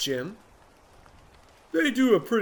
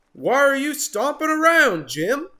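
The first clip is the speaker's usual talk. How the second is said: Marabou's voice; 11 semitones higher, 7 dB louder than usual. Acted.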